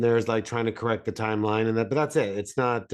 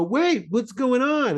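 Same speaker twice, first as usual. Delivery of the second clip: confused; 11 semitones higher, slower than usual. Retold or imitated. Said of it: "and you go".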